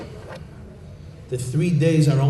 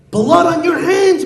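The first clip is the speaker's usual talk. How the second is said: high loud accusation; accusing; 14 semitones higher, 6 dB louder than usual. Acted. Community head accusing Chaim Yankel, loud and high. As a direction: hurl the blame at him. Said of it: "rebuking him and chastising him".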